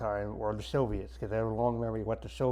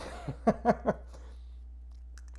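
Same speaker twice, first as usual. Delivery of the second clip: laugh; 10 semitones higher, 4 dB quieter than usual. Real, not acted. Laughs at the fake movie effects.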